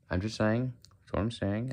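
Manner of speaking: singsong Indian English. Shown as a demonstration. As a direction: keep the put-on Indian lilt. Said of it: "I can do a very stereotypical".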